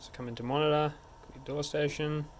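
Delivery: ordinary talk, nothing marked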